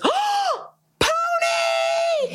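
very high, drawn-out gleeful shriek; excited squeal. Retold or imitated. Friend calling across the sales floor, excited squeal. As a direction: imitate a thrilled squeal across a big room.

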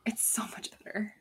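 soft, voiceless whisper; whispered emphasis. Real, not acted. Repeating a verdict on "uni" over "college", whispered.

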